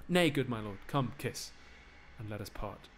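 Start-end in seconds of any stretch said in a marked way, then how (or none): none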